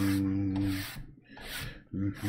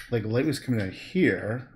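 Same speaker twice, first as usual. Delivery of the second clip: usual speaking voice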